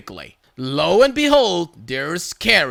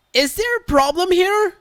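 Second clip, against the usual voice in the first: much higher lightly voiced sweet older lady; old lady worker; 12 semitones higher, 4 dB louder than usual. Old worker checking on the quarrel, lightly voiced and gentle. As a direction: ask gently like a kind grandmother.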